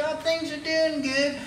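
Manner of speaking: ordinary delivery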